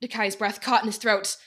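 usual speaking voice

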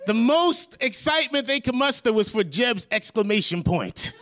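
high, incredulous mockery; mock exasperation. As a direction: sell the disbelief, voice up. Mocking rising exasperation.

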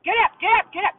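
high, loud mock wake-up yell. A harsh morning host yelling at listeners to get up. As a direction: bark them out of bed, hammed up. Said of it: "yelled at in the morning".

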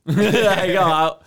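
slow, loud laughing. Real, not acted. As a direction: burst out laughing. Laughing at his own story.

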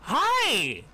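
high, bright-eyed gushing greeting; tiny woman gushing over her friend's looks. Acted. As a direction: squeal hello to an old friend.

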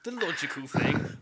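ordinary talk, nothing marked

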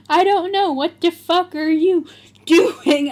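loud, high hammed-up retort; mock angry shout. A narrator's reading. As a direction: yell back in overplayed outrage. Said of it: "I shouted angrily".

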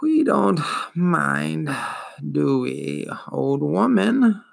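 slow, dragging, wheezing ancient voice; wheezing old turtle. Acted. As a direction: drawl it out like a weary old beast. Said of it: "Morla stared at him".

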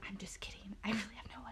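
hushed, whispered aside; whispered confession. Real, not acted. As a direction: drop to a sheepish whisper. Confesses the boast was fake.

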